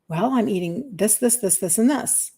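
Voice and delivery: quietly confessing what she eats; sheepish listing. Retold or imitated. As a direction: mumble the list quietly like owning up. Friend retells her sheepish answer.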